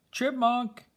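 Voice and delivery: put-on the boy calls down into Chipmunk's hole; boy calling into a hole. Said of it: "Daniel calls into Chipmunk's hole".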